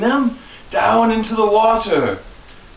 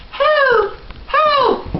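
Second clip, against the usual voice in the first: much higher mock cries of help; high princess cries for help. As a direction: call for help like a princess in distress. Princesses calling out.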